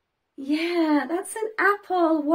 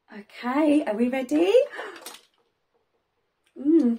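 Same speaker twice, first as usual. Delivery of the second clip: ordinary delivery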